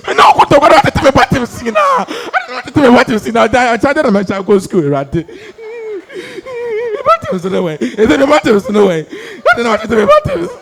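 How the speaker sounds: high, loud, hammed-up crying words; boy fake-crying about torn buttons; mock sobbing wail. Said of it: "I pinned the crying on the buttons".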